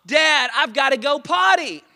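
high, loud imitation of a yelling kid; mock child's shout from bed. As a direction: holler like a kid calling parents. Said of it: "that was yelling to us".

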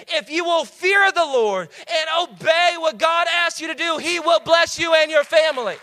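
high, loud, slow preacher's cry; full-force preaching. Acted. Pastor exhorting the parents, loud and high. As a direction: drive it home to the congregation.

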